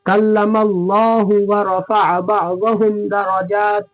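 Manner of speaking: slow, measured chant with held long vowels; Quran recitation. Shown as a demonstration. Teacher reciting the verse for students, slow measured chant. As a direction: recite slowly and evenly, drawing out long syllables.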